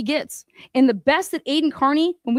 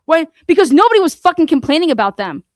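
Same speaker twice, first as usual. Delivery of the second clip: louder, furious outburst; real furious yell about the complaints; angry shout; 2 semitones higher, 6 dB louder than usual. Real, not acted. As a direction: bark it out in rage. Angry rant, shouting point.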